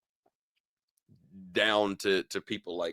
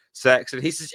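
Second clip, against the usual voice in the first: normal talking voice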